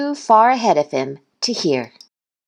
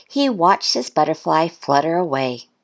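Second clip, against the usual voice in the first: plain reading voice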